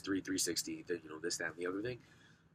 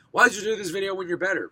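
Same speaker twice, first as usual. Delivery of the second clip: imagined viewer; 9 semitones higher, 11 dB louder than usual. Retold or imitated. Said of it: "you're probably wondering".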